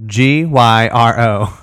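letter by letter, slowly; spelled out slowly. Shown as a demonstration. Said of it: "no, Euro".

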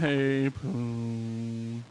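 lightly voiced slow pothead; a hint of a stoned drawl; stoner voice. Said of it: "It totally sounds like a pothead".

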